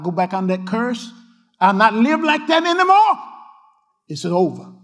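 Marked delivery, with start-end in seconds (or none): 1.6-3.2 louder and higher vow; fervent shout. Real, not acted. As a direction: burst out with the vow. Declaration, suddenly shouted.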